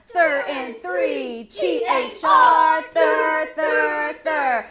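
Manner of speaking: loud football chant; football player. Shown as a demonstration. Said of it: "be a football player".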